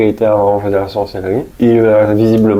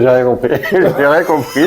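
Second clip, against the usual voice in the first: higher voice broken by laughter; laughing; 5 semitones higher than usual. Real, not acted. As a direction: laugh through your own bafflement. Laughing while recalling an eerie moment.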